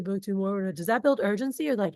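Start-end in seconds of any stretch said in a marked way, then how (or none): none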